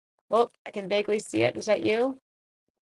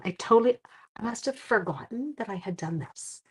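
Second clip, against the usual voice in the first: ordinary delivery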